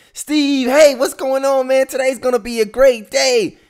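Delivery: bright, very high and loud cheer; cheerful wake-up call. Retold or imitated. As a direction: sing out the greeting like a morning hype man.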